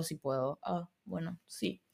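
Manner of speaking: ordinary talk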